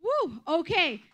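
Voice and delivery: ordinary delivery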